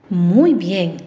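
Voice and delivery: ordinary delivery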